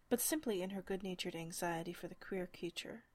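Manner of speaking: ordinary delivery, nothing marked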